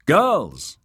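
called out high and loud; calling out. A narrator's reading. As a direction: call across to the girls. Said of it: "Sahil called to them".

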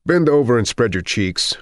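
no-nonsense clinical command; blunt doctor. A narrator's reading. Doctor's exam order.

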